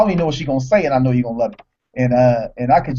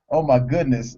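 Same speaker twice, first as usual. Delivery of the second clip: ordinary talk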